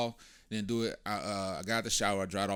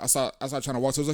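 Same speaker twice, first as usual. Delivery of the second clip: ordinary delivery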